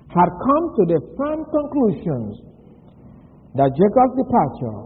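normal talking voice

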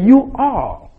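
"You all" pitched high, then falling; emphatic YOU ALL. Shown as a demonstration. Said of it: "that it is emphatic".

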